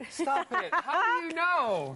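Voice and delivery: high, loud and laughing; laughing protest. Real, not acted. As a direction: squeal it through laughter.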